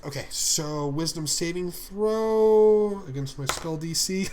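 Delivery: ordinary talk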